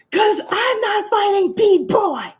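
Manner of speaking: high, drawn-out angry yell; pissed off. Real, not acted. Streamer failing to find the character viewers asked for, pissed off. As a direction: screech it slowly in exasperated rage. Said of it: "I'm getting pissed".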